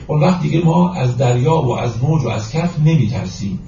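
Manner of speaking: usual speaking voice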